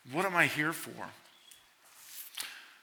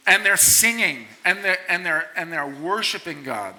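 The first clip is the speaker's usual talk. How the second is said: ordinary talk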